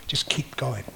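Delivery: soft whisper. Retold or imitated. Encouraging himself through the walk.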